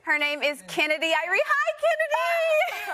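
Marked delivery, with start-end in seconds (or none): 1.4-2.9 high, slow sing-song hello; sing-song greeting. Real, not acted. Mother greets her daughter in the studio, high sing-song. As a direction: call out to her daughter sweetly.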